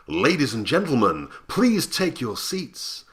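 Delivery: big, high, drawn-out stage announcement; high announcer. Shown as a demonstration. Announcer seating the audience before a performance, high and showy. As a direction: call the audience in, high and loud. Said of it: "starting quite high and then going more mysterious".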